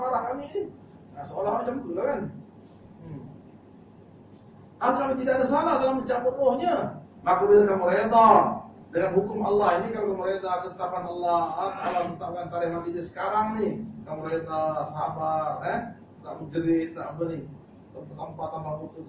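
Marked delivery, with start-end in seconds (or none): 4.9-13.7 preaching at full force. Acted. Preacher exhorts congregation.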